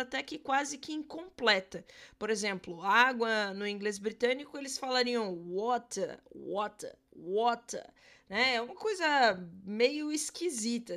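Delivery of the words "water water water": water with no R at the end, ending on an open vowel; British water. Shown as a demonstration. Teacher demoing British water without the final R. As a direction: drop the R in water, British style. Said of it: "não costumam ficar pronunciando muito a questão do R".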